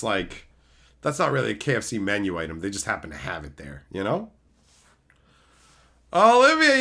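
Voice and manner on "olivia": high, loud mock telling-off; mock scolding. Mock scolding chat for misspelling.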